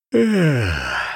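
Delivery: a long drawn-out yawn sigh. Acted. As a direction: yawn out the word. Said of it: "закончил зевок".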